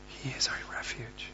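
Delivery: whispered scripture close; breath-soft, reverent ending; reverent hush. Acted.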